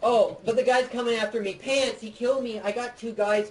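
high-pitched, urgent complaint; excited alarm. Real, not acted. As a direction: report the attack to your teammate, worked up. Chased and killed in game.

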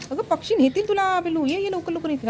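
high-pitched motherly coaxing. Real, not acted. Warning and calling her toddler.

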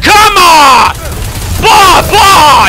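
loud, high battle yell; high shouting gamer hype; excited shout. Real, not acted. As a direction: yell at the enemy in the heat of it. Shotgun fight excitement.

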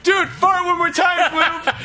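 high shouted demand; excited shout. Acted. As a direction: yell it at the party. Kids demand Gloop fart.

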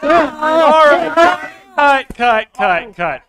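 loud, high call to stop the take; calling cut. Real, not acted. Director stopping the scene, calling loud and high. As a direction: shout it out to halt the actors.

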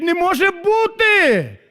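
high, slow disbelieving cry; shocked cry. Retold or imitated. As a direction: cry out that it cannot be. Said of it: "хтось кричить".

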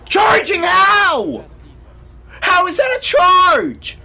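high, loud disbelieving yell; outraged shout. Real, not acted. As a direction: scream at the bad call. Outraged at charge call.

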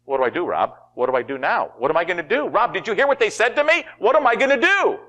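hammed-up rising desperation; acted panic. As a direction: play someone losing it, pitch climbing steadily. Acting out someone panicking to Rob.

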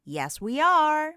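usual speaking voice

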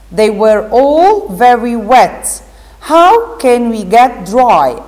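teacher reading a story to pupils; reading to children. Acted. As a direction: read the story aloud to the class with expression. Teacher reads Alice story aloud.

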